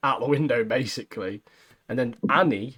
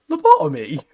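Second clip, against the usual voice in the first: a mock baffled Lobotomy?, louder and higher; dead confused; 5 semitones higher, 8 dB louder than usual. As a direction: echo the strange word like it makes no sense. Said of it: "Like, dead confused".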